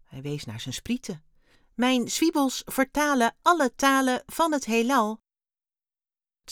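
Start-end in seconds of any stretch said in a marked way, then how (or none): 1.7-5.2 loud, higher-pitched alien voice; alien Wim. A narrator's reading. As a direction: proudly explain as a little alien, high and loud. Alien figure speaks. (hoge, luide stem van een ruimtewezentje)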